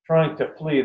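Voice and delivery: usual speaking voice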